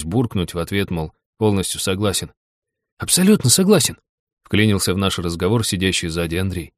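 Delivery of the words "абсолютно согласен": high-pitched, keen interjection; eager agreement. A narrator's reading. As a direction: chime in brightly from the back seat. Андрей chimes in from behind. (горячо поддакнуть)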